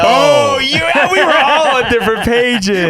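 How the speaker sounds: high, loud laughing voice. Real, not acted. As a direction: laugh through the realization. Laughing at the mixup.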